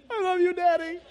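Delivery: put-on high little-child voice, half laughing. Forgiven child telling dad he loves him, high. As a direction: squeak it like a sorry toddler. Tearful child hugs father.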